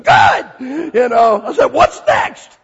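loud, high eager shout. Retold or imitated. New convert shouting to his pastor, eager.

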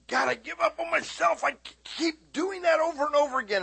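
high, loud, despairing cry of self-blame; anguished self voice. Retold or imitated. Preacher acts himself crying out.

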